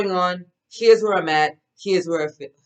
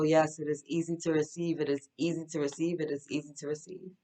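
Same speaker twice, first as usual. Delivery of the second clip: fading mantra; 9 dB quieter than usual. Acted. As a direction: repeat it, softer each time until almost gone.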